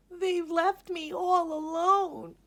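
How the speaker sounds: a lonely spider's tearful cry. A narrator's reading. Miss Spider abandoned by the moths, high tearful cry. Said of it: "she cried".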